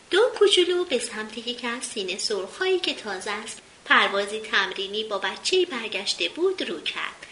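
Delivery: usual speaking voice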